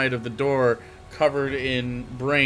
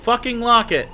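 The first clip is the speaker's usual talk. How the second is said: louder, higher, fed up; exasperated shout; 10 semitones higher, 7 dB louder than usual. Acted. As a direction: bark it in disbelief. GM blurts exasperated command.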